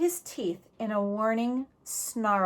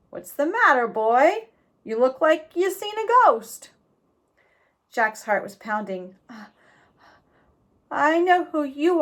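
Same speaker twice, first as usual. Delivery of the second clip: higher, louder folksy outlaw; old road agent; 5 semitones higher, 3 dB louder than usual. A narrator's reading. Reformed road agent chatting with Jack, folksy and amused.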